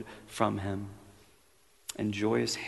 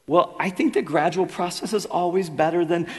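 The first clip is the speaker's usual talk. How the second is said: higher, louder, nervous hedging voice; hedging ghost; 9 semitones higher, 7 dB louder than usual. Acted. Ghost stalls the angel with excuses, nervous. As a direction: stall for time, anxiously.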